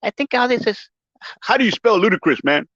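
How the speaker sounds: high-pitched Mike Tyson imitation, slowing to spell it out; jokey Mike Tyson impression. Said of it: "my voice is like, like Mike Tyson right now".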